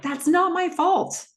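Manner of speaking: high, pushed-up defensive snap. Retold or imitated. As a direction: fling the blame off, pitched high. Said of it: "She is defensive here".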